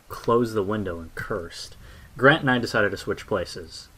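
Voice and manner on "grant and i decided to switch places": ordinary delivery, nothing marked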